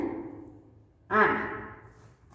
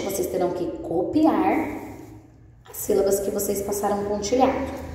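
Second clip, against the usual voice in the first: ordinary talk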